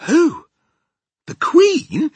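very high, loud, shocked question; aghast cry. Acted. Sophie hearing the Queen may be eaten, aghast. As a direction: exclaim in horrified disbelief. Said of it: "Sophie cried, aghast".

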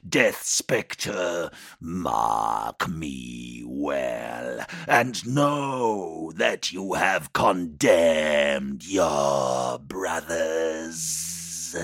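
slow, otherworldly demon curse. Acted.

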